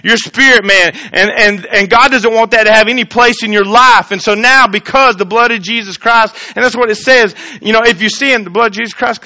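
loud, high preaching voice; fervent sermon delivery. Acted. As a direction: preach it out to the congregation. Preaching at full force.

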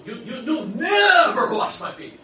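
loud, slow stammering outburst; Peter protesting. Acted. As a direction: plead loudly, tripping over the you. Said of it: "and he goes".

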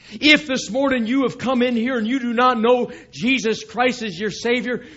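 normal talking voice

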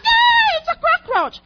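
shrill high startled shout; mock fright. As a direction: shriek at the bug in mock panic. Mock scared cry at cockroach.

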